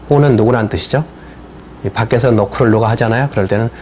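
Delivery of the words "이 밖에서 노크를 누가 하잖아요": ordinary talk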